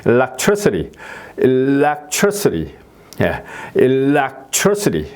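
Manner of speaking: very slow electricity, level then up on TRI and down; drawn-out electricity, flat then peaking on TRI; stress on third syllable. Shown as a demonstration. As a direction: stretch electricity: level start, climb to TRI, fall away. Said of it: "평지로 갔다가 이제 올라갔다 내려오는 거죠".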